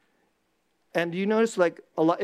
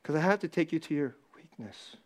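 soft, breathy delivery; whispered; 3 semitones lower, 6 dB quieter than usual. Real, not acted. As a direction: lean in and whisper it. Sermon point, whispered.

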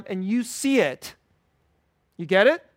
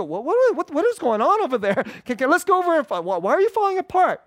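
higher, tumbling, flustered questions; mock panic; 6 semitones higher than usual. Imagined churchgoer to a crying stranger, mock panic. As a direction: play a flustered churchgoer rushing over.

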